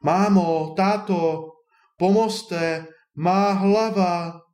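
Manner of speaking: high, drawn-out cry of alarm; crying for help. A narrator's reading. Drenched boy calling mother and father, crying for help. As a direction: wail for help, pitch up, slowing at the end. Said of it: "křičel, co hrdlo stačilo".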